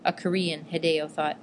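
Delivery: plain reading voice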